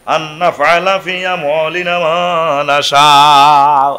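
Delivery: slow chanted Quran recitation, drawn out at the close. Acted. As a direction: intone the verse slowly, like a reciter. Quran verse recited in sermon. (ধীর সুরে কুরআন তিলাওয়াত)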